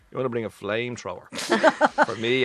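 a scoffing dad; dad's joke. Retold or imitated. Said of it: "my dad saying this".

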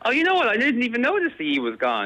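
suddenly high, genuinely surprised. Real, not acted. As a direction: caught off guard, voice jumps up. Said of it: "Oh, interesting".

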